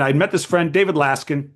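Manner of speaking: usual speaking voice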